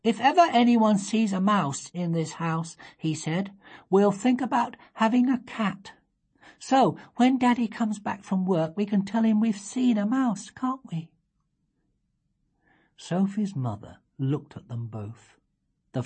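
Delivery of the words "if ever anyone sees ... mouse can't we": put-on a touch higher, sly little-girl voice; five-year-old slyly suggesting they tell Daddy; lightly voiced scheming child. A narrator's reading. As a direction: play it innocent but plotting.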